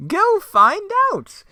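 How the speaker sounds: high, loud, slow command; Joker voice. Acted. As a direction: order them like a cartoon villain. Joker orders henchman.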